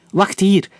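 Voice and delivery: command. A narrator's reading. Captain orders his men to stay put, firm command. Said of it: "beval de kapitein".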